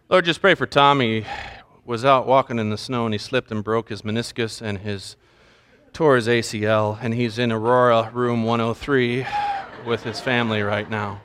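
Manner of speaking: low, subdued prayer voice; over-detailed mock prayer. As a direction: drone through a pretend prayer full of details. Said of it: "heard the person pray".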